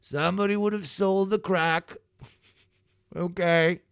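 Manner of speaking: high-pitched, put-on self-justifying dealer; mock drug dealer. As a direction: argue innocence in a high, silly voice. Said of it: "of a drug dealer".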